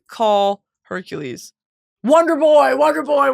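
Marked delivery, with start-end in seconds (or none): 2.0-3.3 loud, high burst of recognition; real eureka shout; excited recall. Real, not acted. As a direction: blurt it out as it comes back to you. Suddenly remembers the nickname.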